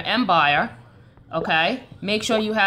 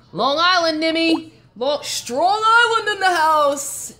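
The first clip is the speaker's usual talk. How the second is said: high, loud whoop for Long Island; hometown shout-out; 8 semitones higher, 5 dB louder, slower than usual. Real, not acted. Streamer hyping Long Island to a viewer, rowdy shout-out. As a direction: belt it out like a proud hometown cheer.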